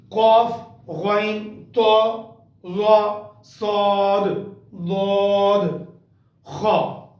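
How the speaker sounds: each heavy letter said with a full mouth; thick full-mouth letters. Shown as a demonstration. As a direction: qaf ghain ta za sad dad kha, thick and heavy. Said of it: "thicker voice".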